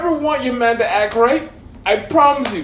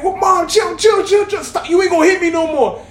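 frantic protest; 6 semitones higher than usual. Retold or imitated. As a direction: try to stand up to her. Son trying to stop mother.

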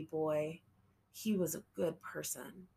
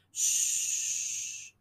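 mother's calming shush; soothing shush; 4 dB louder than usual. A narrator's reading. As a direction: hush him gently. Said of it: "she told him".

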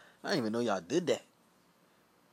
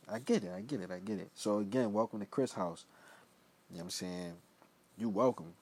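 ordinary talk, nothing marked

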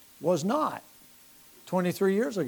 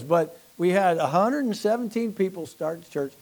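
ordinary talk, nothing marked

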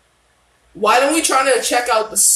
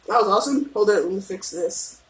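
ordinary delivery

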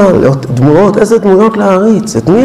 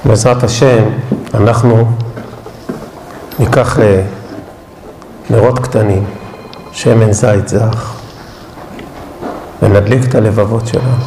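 lower, soft and unhurried; prayerful guided reflection; 9 semitones lower, 4 dB quieter than usual. Acted. Rabbi guiding the congregation to light candles, low and soft. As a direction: lead them gently toward the candles.

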